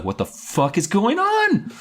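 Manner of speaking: high, drawn-out disbelief; losing it. Retold or imitated. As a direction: stretch it out in mock bewilderment. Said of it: "I lost my mind a little bit".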